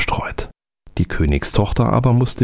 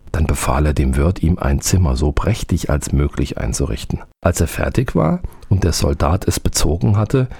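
ordinary delivery, nothing marked

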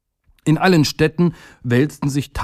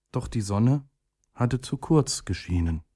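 ordinary delivery

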